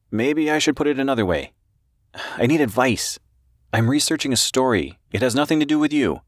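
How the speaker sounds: high-pitched, earnest reporter voice; anxious reporter. A narrator's reading.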